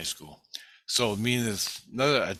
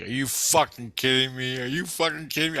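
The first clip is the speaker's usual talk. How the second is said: disbelief; 5 semitones higher, 4 dB louder than usual. Retold or imitated. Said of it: "And I'm like".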